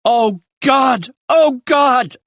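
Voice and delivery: high, loud wail of grief; screaming in anguish. A narrator's reading. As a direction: cry out to God, shattered. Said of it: "crying, gasping, screaming".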